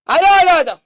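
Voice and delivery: loud, high call to come in. Real, not acted. Teacher calling students inside, loud and high. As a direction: beckon them loudly.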